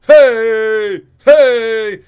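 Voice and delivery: high, very loud repeated hey; mocking coach's shouts. Mocking the fighter's coach yelling hey from the corner. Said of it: "Marion Reneau's coach says".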